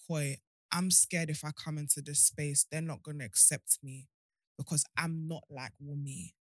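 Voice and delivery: ordinary talk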